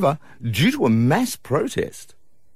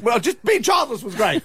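mock quote; 10 semitones higher than usual. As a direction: tease with a put-on voice. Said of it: "you're like".

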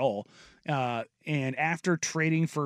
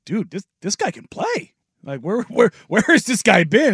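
amazed; 6 semitones higher, 8 dB louder than usual. Retold or imitated. Retold amazed reaction at Summer League.

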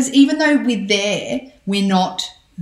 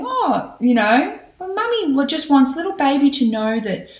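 higher, gentle pretend mummy bunny; toy bunny voice; 6 semitones higher than usual. Parent plays with toddler's toy bunny, higher pretend voice. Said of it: "get your little bunny and say".

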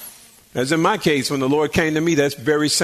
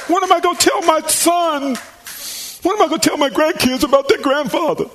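high-pitched imitation of a tearful lament; hammed-up crying plea; mock distraught wail; 10 semitones higher than usual. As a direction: wail it out in comic despair. Acts out people crying over him.

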